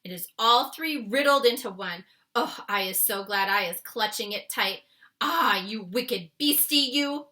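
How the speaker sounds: loud, booming BFG voice; the giant scolding the dream in the jar; crying giant voice. Acted. The BFG shouts at the trapped dream, loud and scolding. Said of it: "he cried".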